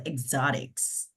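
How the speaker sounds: "exotics" in a put-on American accent; American-style exotics. Shown as a demonstration. Host checking the word in her twang, American-accented "exotics". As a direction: say "exotics" the American way, not the Jamaican. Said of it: "Not how we say it in Jamaica".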